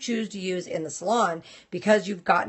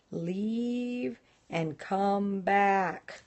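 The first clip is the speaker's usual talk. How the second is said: very slow, softer, each word stretched for lip-reading; exaggerated mouthing; 6 dB quieter, much slower than usual. Shown as a demonstration. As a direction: mouth it slowly so she can read your lips. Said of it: "Let's see if I can sign language to her".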